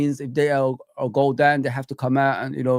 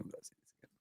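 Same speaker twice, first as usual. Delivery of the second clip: breathy whisper, almost to yourself; 31 dB quieter, much faster than usual. Real, not acted. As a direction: whisper it under your breath. Repeating line, dropping to whisper.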